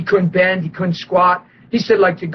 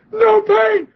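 excited shout; 14 semitones higher than usual. Retold or imitated. Healed man answering the preacher, a high excited shout. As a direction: blurt it out in wonder.

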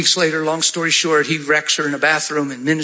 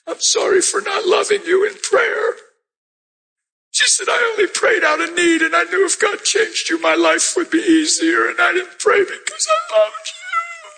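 crying confession. Retold or imitated. Wife crying her confession to husband: very high sobbing voice. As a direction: cry it out, voice cracking upward. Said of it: "crying, and she said".

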